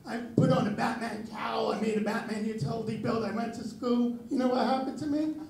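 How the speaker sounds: ordinary talk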